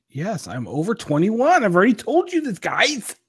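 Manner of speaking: play-exasperated, pitch climbing high; mock exasperation. Assuring listeners he is over twenty-one, mock exasperated. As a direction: whine it in mock protest, voice soaring. Mock protest at looking underage.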